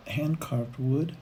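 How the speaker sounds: ordinary talk, nothing marked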